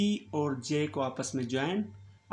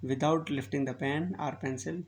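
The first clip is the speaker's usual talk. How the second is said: without lifting the pen or pencil said very slowly; slow instruction; much slower than usual. Shown as a demonstration. Teacher slowly explaining how to join strokes. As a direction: spell out each word of the instruction. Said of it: "आपस में join करेंगे".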